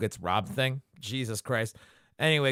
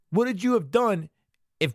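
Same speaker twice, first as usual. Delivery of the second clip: indignant rant; 8 semitones higher than usual. Real, not acted. Ranting at Chi-Chi.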